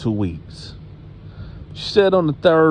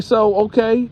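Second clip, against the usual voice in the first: interrogating grandma; 9 semitones higher than usual. Retold or imitated. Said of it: "She was like".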